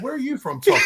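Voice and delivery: high laughing call; laughing shout. Real, not acted.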